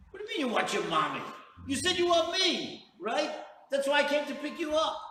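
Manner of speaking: loud, high-pitched indignant protest; exasperated father. Retold or imitated. Father who came to get his crying son, acted exasperated shouting. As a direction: argue back at full volume, exasperated.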